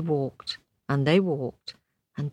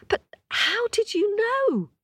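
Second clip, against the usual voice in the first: high, amazed question. Acted. Hunter asks the blind man how he knew, amazed. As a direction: ask in wide-eyed wonder.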